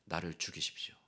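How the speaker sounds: whispered plea. Acted. Jonah's plea ends.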